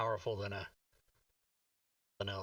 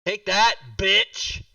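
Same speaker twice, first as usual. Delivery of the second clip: loud, higher shout at a foe; triumphant taunt; 6 semitones higher, 11 dB louder, much slower than usual. Real, not acted. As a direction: hurl it at the beaten enemy. Gloating over the landed hit.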